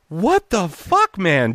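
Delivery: high, drawn-out, indignant disbelief; exasperated protest. Retold or imitated. Pranked voice actor to the laughing producers, exasperated protest.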